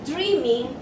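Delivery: ordinary talk, nothing marked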